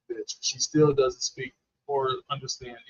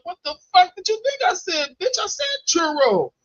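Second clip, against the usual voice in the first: high-pitched mock outrage; exasperated; 13 semitones higher than usual. Customer snapping back at Subway worker, exasperated. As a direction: retell it as an indignant comeback, pitched way up. Retold quarrel over the order.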